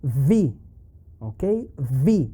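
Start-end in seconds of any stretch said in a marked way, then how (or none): 0.0-0.5 "V" said as English "vee"; "V" pronounced the English way, not as in Spanish; English V sound. Shown as a demonstration. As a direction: say "V" with a clear English v, unlike Spanish B. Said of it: "in English it is pronounced".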